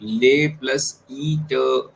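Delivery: ले, ई and ट said slowly as separate sounds; very slow teacher's breakdown of ले, ई, ट; broken-down pronunciation. Shown as a demonstration. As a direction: sound out ले plus ई ट piece by piece. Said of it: "हम इसको क्या पढ़ेंगे".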